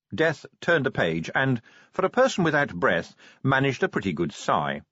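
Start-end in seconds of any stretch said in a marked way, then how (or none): none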